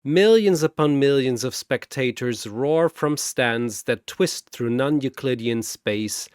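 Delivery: ordinary delivery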